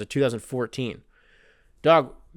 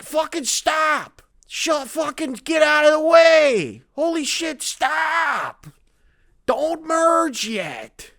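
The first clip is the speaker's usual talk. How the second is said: much higher, louder ranting shout; 12 semitones higher, 4 dB louder, much slower than usual. Retold or imitated. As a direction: explode in an exasperated comic rant.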